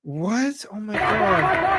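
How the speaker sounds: a sudden high, amazed gasp of words; real astonishment. Real, not acted. Vlogger reacting to a protest clip, real astonishment. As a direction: caught off guard, voice jumps up.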